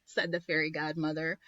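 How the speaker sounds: plain reading voice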